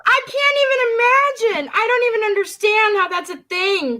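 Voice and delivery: surprised. Real, not acted. Disbelief at the butt plug.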